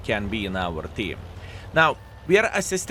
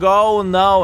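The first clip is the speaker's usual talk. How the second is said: higher, louder hammed-up cry of panic; mock scream; 7 semitones higher, 8 dB louder than usual. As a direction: yell oh no like a doomed enemy. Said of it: "Ergashem is screaming".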